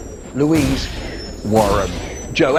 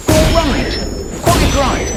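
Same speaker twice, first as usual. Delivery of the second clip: delighted teacher; 13 semitones higher, 6 dB louder, slower than usual. Retold or imitated. Teacher praising answer.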